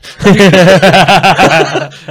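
loud high burst of real laughter; big laugh. Real, not acted. Teacher laughing at her colleague's huff, loud and high.

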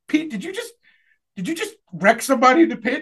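high, amazed question; surprised high voice. Retold or imitated. Sister's amazed question retold.

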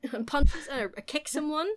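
usual speaking voice

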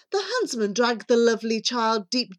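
ordinary delivery

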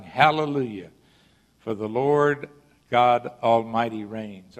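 loud, proclaimed scripture, slowing at the end; quoted shout. Acted. Preacher voicing the great multitude in Revelation, loud proclamation. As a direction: proclaim it loudly, short of a full shout.

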